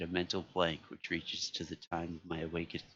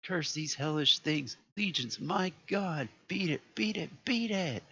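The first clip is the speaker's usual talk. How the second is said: high, loud, slow shrieking; rising into a final scream of horror; doomed man shrieking; horrified shriek; 10 semitones higher, 5 dB louder than usual. A narrator's reading. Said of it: "a cry that gradually rose to a shriek".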